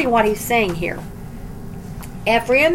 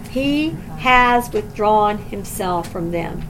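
sorrowful; 3 semitones lower, slower than usual. Shown as a demonstration. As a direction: let the saddest phrase sink in slowly. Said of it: "There is no sadder phrase than this".

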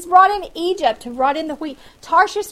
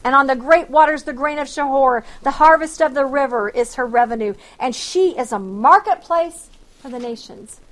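forceful scripture reading; 5 dB louder than usual. Acted. Preacher proclaiming Isaiah's oracle on Egypt to the congregation, forceful reading. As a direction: proclaim the verse, a touch louder.

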